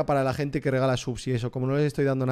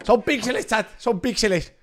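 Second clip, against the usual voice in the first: high-pitched exclaimed objection to the chat; exasperated high-pitched insistence; 7 semitones higher, slower than usual. Real, not acted. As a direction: protest in a raised voice, drawing it out. Protesting loudly to the chat.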